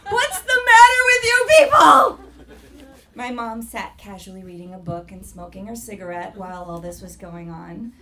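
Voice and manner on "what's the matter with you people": shrill horrified yell through laughter; outraged shout. Retold or imitated. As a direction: yell at the family in disbelief. Child outraged at family.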